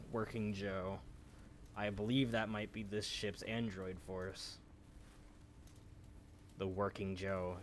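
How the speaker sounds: ordinary delivery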